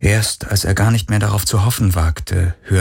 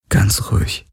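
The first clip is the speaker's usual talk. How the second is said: gentle soothing hush; 2 semitones higher than usual. A narrator's reading. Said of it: "sagte er mit sanfter Stimme".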